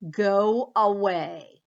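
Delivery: drawn-out, loud dismissal; slow loud go away. Acted. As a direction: push the mouse off slowly. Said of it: "Bear said".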